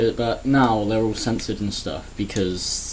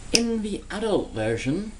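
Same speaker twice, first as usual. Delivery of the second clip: spooky storyteller voice; 9 semitones higher, 6 dB quieter than usual. Acted. Ominous tone for adult version.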